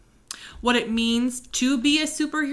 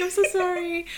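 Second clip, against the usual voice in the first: usual speaking voice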